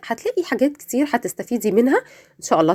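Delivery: ordinary delivery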